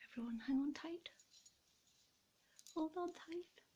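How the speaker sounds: hushed coaxing to the ornaments; whispered urging. Real, not acted. Owner whispering to the baubles as she lifts them. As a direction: whisper the warning to hold on tight.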